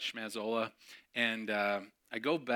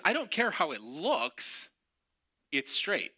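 stubborn dismissal; 5 semitones higher, 3 dB louder, slower than usual. Retold or imitated. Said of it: "He goes".

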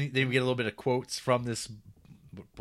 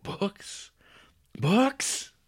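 high rising double take; mock surprise; 10 semitones higher than usual. Podcast host plays Lincoln thrilled by books, high incredulous double take. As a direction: gasp it out, then shout it in delight. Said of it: "Abraham Lincoln's like".

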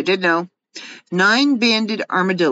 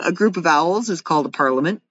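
ordinary talk, nothing marked

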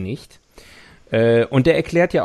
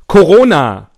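loud announcement; 4 semitones higher, 12 dB louder than usual. Acted. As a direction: belt out the word like a headline. Announcing the headline.

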